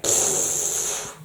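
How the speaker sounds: voiceless, soft "pfft"; dismissive raspberry. Acted. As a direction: blow a scornful puff through the lips. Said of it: "And Lois is like".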